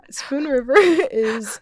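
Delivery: giggling through the words, slowly; cracking up mid-sentence; laughing hard. Real, not acted. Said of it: "I just snorted a little bit".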